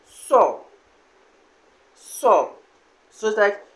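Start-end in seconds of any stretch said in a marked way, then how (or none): 0.1-0.6 "So" as one stressed, falling syllable. Shown as a demonstration. Teacher repeating the word for collect, falling stress. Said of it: "rather a falling stress". 1.9-2.5 "So" stressed, sliding down in pitch; falling stress. Shown as a demonstration. Teacher drilling the word for collect, falling stress. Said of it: "rather a falling stress".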